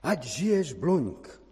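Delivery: slow, high cheer; lightly acted call of acclaim. A narrator's reading. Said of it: "když volal".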